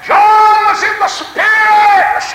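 high, loud, slow revival cry; preaching shout. Real, not acted. Preacher bursts into full-force shout.

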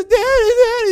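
put-on loud, high child's wail; mock bawl for Daddy's comfort; crying little boy. Soaked boy runs to his father, acted desperate wailing. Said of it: "He's crying".

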